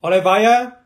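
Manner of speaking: "Olivia" as oh-lih-VYE-uh, loudly questioned; mispronounced name. Acted. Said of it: "It's Olivia".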